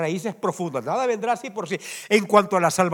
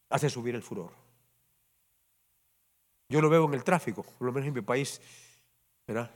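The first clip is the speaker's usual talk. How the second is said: ordinary talk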